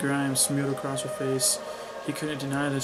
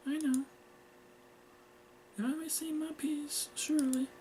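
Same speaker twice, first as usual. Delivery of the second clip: put-on high, soft girlish voice; meek daughter; 13 semitones higher, 5 dB quieter than usual. Girl asks to speak to her mother, meek. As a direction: plead meekly, small and high.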